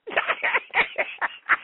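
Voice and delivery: high, helpless burst of laughter; uncontrollable laughter. Real, not acted. Host losing it before muting the mic, high helpless laughter. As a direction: let a real laughing fit break loose. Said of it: "I'm about to start screaming".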